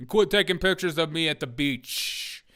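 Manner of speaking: lightly put-on accent on Quit; mock Christie accent. Streamer imitating Christie complaining about beach photos, light mock accent. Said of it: "that accent".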